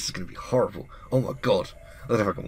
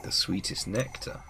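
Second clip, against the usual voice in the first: softer, whispered witch voice listing the gifts; a hushed menacing witch; 8 dB quieter, slower than usual. Acted. Witch gloating over the girl's offerings, hushed. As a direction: breathe it out like a slow threat.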